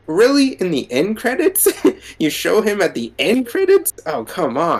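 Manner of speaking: high, disbelieving and indignant; incredulous. Retold or imitated. Fan scoffing at the show revealing Jake in the end credits, high and incredulous. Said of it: "I'm like".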